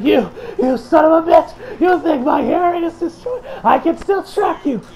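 high, loud villain rant; hammed-up rage. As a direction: rage at the opponent, acting it out. Acts out villain's angry threat.

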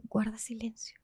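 hushed, voiceless command; susurro. Retold or imitated. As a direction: whisper it sharply to your friend. Susurrándole a la amiga. (susurrado, sin voz)

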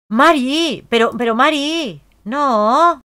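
high, loud alarmed cry of the name; startled yell; alarmed shout. Real, not acted. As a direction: shout in shock at what she sees. Sim faints in game.

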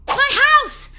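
high, loud cry of relief; excited cry. Acted. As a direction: shout it, overjoyed to be home. Relieved to reach home.

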